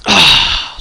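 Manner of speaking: big, loud exaggerated sigh; imitated sigh. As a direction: heave a loud mock sigh. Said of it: "like any kind of sigh".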